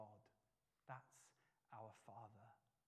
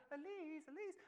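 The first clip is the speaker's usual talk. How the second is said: high calling, much higher pitch; others calling; 14 semitones higher than usual. Retold or imitated. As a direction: strangers calling for the lost girl. Other people calling too.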